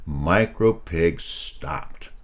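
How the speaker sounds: plain reading voice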